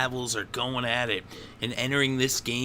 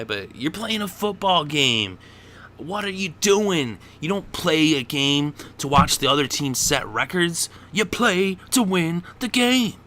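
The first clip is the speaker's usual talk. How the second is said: rising louder and higher, fed up; exasperated rant; 7 semitones higher, 6 dB louder than usual. Real, not acted. Fan scolding the Niners for cheering Peyton, exasperated. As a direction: scold them like a frustrated fan.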